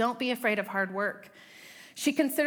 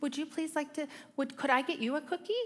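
put-on sweet kids; 6 semitones higher, faster than usual. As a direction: imitate overly sweet polite children, much higher. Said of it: "they all spoke so sweetly to each other".

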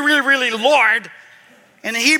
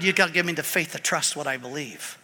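usual speaking voice

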